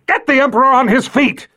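high commanding yell; yelling order. Acted. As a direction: bark the order at them. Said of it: "he yelled at the wall".

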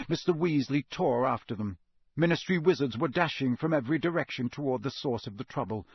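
ordinary delivery, nothing marked

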